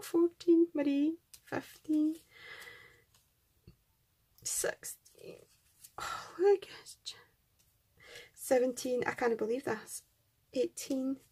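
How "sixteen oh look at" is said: whisper. Real, not acted. Admiring die cuts.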